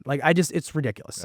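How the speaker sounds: ordinary talk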